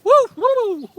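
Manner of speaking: high, loud "woo"; sudden whoop. Real, not acted. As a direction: let out two short high whoops. Sudden whoops in mid-talk.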